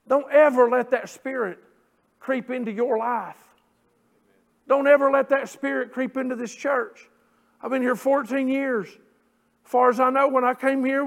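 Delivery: loud, ringing pulpit delivery; full-force preaching. Acted. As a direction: proclaim the verses to the congregation. Preaching exhortation.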